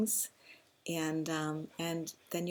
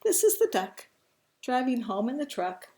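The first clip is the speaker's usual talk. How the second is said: animated reading, loud on the last words; picture-book storytelling; 5 semitones higher, 7 dB louder than usual. A narrator's reading. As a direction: hold back, then land the punchline loudly. Reads the book's punchline.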